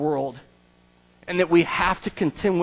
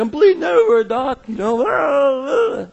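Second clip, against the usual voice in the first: high, loud pained cries to the doctor; patient crying out in pain to the doctor; desperate patient; 9 semitones higher, 5 dB louder, slower than usual. Retold or imitated. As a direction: wail like a panicked patient in triage. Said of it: "You're like".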